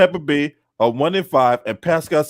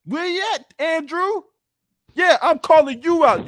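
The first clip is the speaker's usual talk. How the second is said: taunting call; 9 semitones higher than usual. Acted. Taunting a rival by name.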